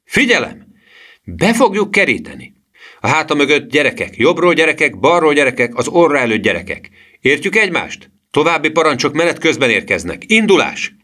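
rallying, high-pitched commands; shouted orders. A narrator's reading. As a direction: call out orders to a troop. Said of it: "kiáltotta a professzor".

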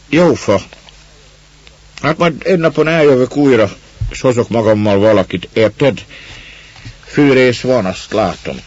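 put-on a rough neighbour talking down to a child; gruff woodsman. A narrator's reading. Man talking to the girl, gruffly.

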